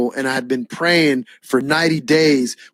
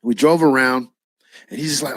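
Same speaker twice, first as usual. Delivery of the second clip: normal talking voice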